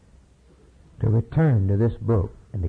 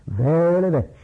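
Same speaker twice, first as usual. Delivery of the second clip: loud emphatic proclamation; 5 semitones higher, 5 dB louder, faster than usual. Acted. Preacher proclaims Christ's words.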